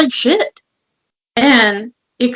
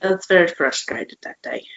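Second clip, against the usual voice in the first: normal talking voice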